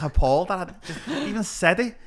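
normal talking voice

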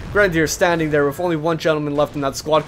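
normal talking voice

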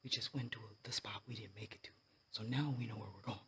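hushed whisper; low whisper to the camera; nervous night vlog whisper. Real, not acted.

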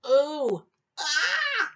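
ordinary delivery, nothing marked

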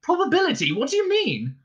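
high, incredulous question; baffled alarm. A narrator's reading. Arthur asking Ford to explain probability, baffled alarm.

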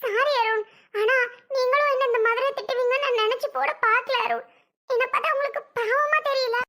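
tearful, high hurt apology to Arun; crying. Acted. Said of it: "என்ன சொல்லிட்டான்னு அழுகுற?".

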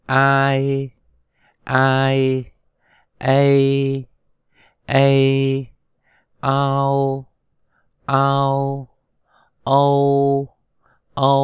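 long, level, held syllables; sustained monotone syllables with pauses between; pronunciation drill. Shown as a demonstration. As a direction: chant each sound slowly for learners to repeat. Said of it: "Chúng ta cùng đọc lại một lần nữa".

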